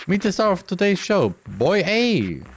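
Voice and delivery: bright, high announcer voice; game show host. Acted. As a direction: present the star like a TV host.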